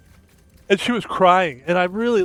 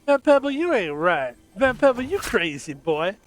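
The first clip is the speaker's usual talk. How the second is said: higher catchphrase call; mock sidekick voice; 5 semitones higher than usual. Streamer voicing an imagined cohost, higher teasing call.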